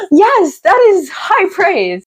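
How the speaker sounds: high, slow, gushing reply; flattered diva voice. Pretend. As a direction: answer like a swooning star. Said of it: "And I'd be like".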